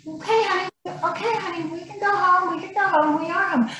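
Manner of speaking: high, softened reassurance; soothing. Retold or imitated. Wife retelling how she calmed her husband pulling her in, soothing. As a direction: placate him gently, as if to a child.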